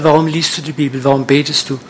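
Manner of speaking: ordinary delivery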